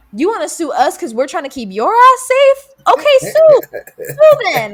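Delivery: rising to a loud, high, incredulous yell; exasperated retort. Retold or imitated. Retelling the clash with a pacing customer, loud exasperated yell. Arguing back at angry customer.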